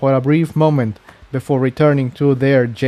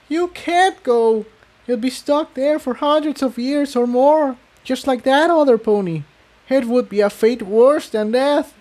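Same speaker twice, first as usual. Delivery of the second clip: put-on much higher young pony voice; Tiptoe; 11 semitones higher than usual. Tiptoe begging Jigsaw not to go, high and pleading.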